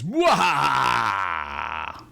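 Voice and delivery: slow, loud evil cackle; sneering panto villain. Acted. Villain cackle after spell.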